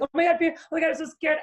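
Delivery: high, hammed-up panicky fretting; mock panic. Mocking panicked people who fear failure, mock scared. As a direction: mimic people freaking out over fear. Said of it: "a lot of times people are like".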